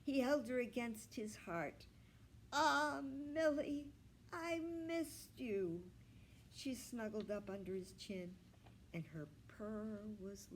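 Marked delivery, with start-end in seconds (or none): 2.5-5.8 high, slow and tender; tender relief. A narrator's reading. Grandpa cuddling his returned cat, slow and tender.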